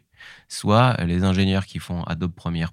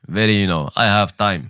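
a louder, put-on Qatari accent drawling in English; a Qatari caricature savouring each word; mock Qatari accent; 4 dB louder than usual. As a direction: lean back like a man with money and time in his Qatari accent. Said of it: "il avait un côté very".

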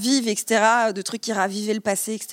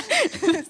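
high evil villain cackle; rire machiavélique; 10 semitones higher than usual. Pretend. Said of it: "C'est un rire machiavélique". (rire diabolique de méchant)

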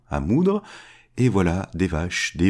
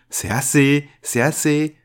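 higher, louder panicked cry; louder, higher desperate cry of the greedy man; 7 semitones higher, 4 dB louder than usual. Acted. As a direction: shout at the mill to stop. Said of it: "Et Yvon crie".

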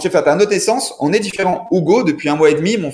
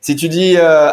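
ordinary talk

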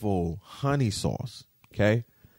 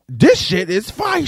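high, loud, slow praise; breaking the silence over great food; excited; 10 semitones higher, 10 dB louder than usual. Retold or imitated. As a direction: savor every word of it. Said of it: "be like".

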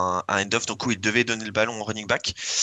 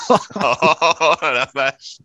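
laughing; 6 semitones higher, 5 dB louder, much slower than usual. Real, not acted. Laughs at a pun.

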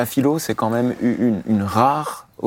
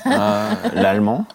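laughing; 10 semitones higher, slower than usual. Real, not acted. Amused at own language claim.

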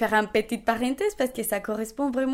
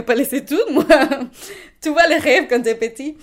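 louder, laughing as she talks; 3 semitones higher, 10 dB louder than usual. Real, not acted. Joking to the interviewer about childhood dreams, laughing. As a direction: let the laugh carry the line.

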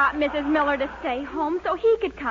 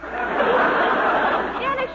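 shocked laugh; 9 semitones higher, 5 dB louder than usual. Acted. Girl shocked at Dennis's lie.

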